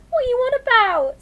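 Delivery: very high, loud protest; upset, shouted wail; tearful outburst. A narrator's reading. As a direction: cry it out in exasperation. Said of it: "still crying".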